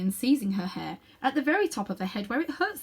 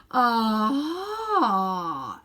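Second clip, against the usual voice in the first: long drawn ah; 3 semitones higher, 5 dB louder than usual. A narrator's reading. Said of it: "gave a long drawn".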